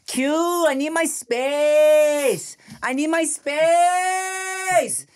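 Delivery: put-on high, long-drawn whiny child voice; mock toddler protest, stretch the last word; imitating fussy little girl. Toddler daughter tells brother to back off, imitated high whine. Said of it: "Veda gets very like".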